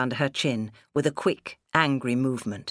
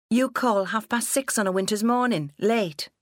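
higher-pitched indignant daughter; snapped retort; 7 semitones higher than usual. A narrator's reading. Daughter resenting her father's rebuke, snapped. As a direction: snap back in resentment, pitched up. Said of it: "Cathy snapped".

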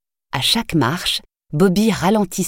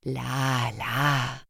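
soothing; 3 semitones lower, 9 dB quieter than usual. A narrator's reading. Nanny calming a crying child.

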